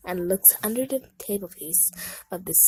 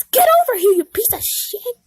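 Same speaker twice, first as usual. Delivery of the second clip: high, loud angry yell; furious shout; 12 dB louder, faster than usual. Acted. Blackberry yelling at fleeing Vel, high and loud. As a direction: roar it as you chase him.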